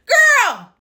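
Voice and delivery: loud, high exclamation; exclaiming. Real, not acted. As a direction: call her out loudly. Exasperated outburst at ballerina.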